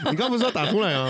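giggling while asking; laughing. Real, not acted. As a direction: ask through laughter. Laughing at friend's hiccup.

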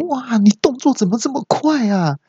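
high-pitched amazed nurse; astonished. Acted. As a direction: exclaim in exaggerated surprise like the nurse. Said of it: "那陈小姐就很惊讶说". (学护士惊讶地高声说)

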